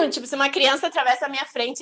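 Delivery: ordinary talk, nothing marked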